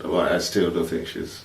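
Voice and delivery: usual speaking voice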